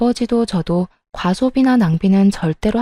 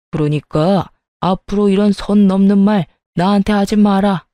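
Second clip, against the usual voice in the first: grumbling father-in-law; 2 semitones lower than usual. Acted. Father-in-law tells off his daughter-in-law, indignant grumbling.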